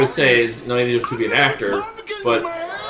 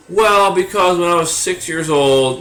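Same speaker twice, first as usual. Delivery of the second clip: higher, slow exaggerated storytelling voice; mock clue explainer; 4 semitones higher, 5 dB louder, much slower than usual. Contestant justifying an obscure clue to viewers, mocking explanation. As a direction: spell out the backstory, higher and louder, dragged out.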